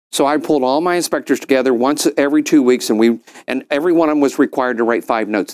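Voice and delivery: ordinary talk, nothing marked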